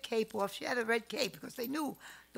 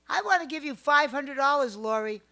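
eager offer; 5 semitones higher, 12 dB louder than usual. Retold or imitated. Friend quoted offering money.

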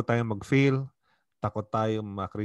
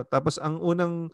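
ordinary talk, nothing marked

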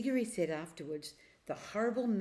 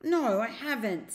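put-on a boy's indignant denial, higher, louder and slower; 5 semitones higher, 7 dB louder than usual. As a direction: deny it, stung and offended. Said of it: "said Diggory indignantly".

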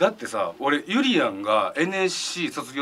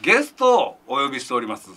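usual speaking voice

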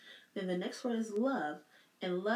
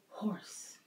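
whispered word; 4 dB quieter than usual. Shown as a demonstration. Teacher whispering the word to help children hear the h. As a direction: whisper "Horse." softly. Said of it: "Does horse begin with letter H".

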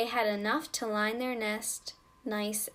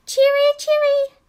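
very high, loud birdsong call; robin call; 14 dB louder than usual. Acted. Mamma Robin calling so Marcella looks up, high loud birdcall. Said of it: "so she began calling".

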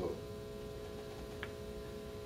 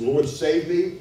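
a louder plea for rescue; crying out; 6 semitones higher, 10 dB louder, much slower than usual. Acted. Sinking Peter cries out to Jesus, pleading loud. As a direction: call for help as if sinking. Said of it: "he cries out and says".

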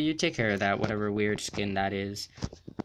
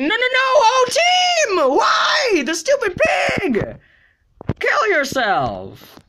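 very high, loud furious screams; shrieking fury at the game; frantic screaming; 9 dB louder, slower than usual. Real, not acted. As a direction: scream in outrage as you lose. Getting eaten in game.

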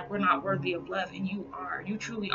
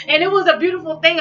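bubbling over with laughter, higher and louder; laughing; 11 semitones higher, 10 dB louder, faster than usual. Real, not acted. Laughing to her fans about their love, high and loud. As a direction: laugh through the line with real delight.

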